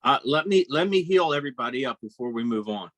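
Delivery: usual speaking voice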